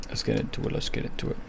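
soft, voiceless whisper. Real, not acted. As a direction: whisper it to yourself, repeating it. Repeats the phrase in a whisper.

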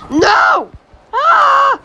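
high, loud anguished yell; real gamer meltdown cry; frustrated scream. Real, not acted. Player misses shot.

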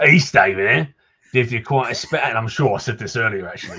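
Cockney local slipping while repeating himself; a Cockney townsman saying it again; Cockney NPC. Acted. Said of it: "for the accent compliment".

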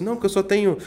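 high, loud imitation of an agitated client. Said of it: "o cliente chega agitado". (imitando cliente agitado)

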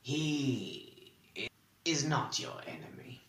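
soft, halting admission; reluctant. A narrator's reading. Answering whether he is to be trusted, reluctantly. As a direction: force the words out reluctantly. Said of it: "The words came reluctantly".

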